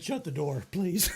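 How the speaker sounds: usual speaking voice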